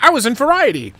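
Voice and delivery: mock-excited Dieter bragging; excited friend. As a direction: gush it like a proud friend. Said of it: "he's like".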